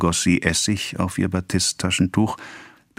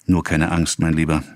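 ordinary delivery

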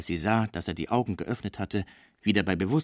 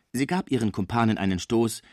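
plain reading voice

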